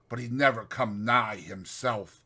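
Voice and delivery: ordinary delivery, nothing marked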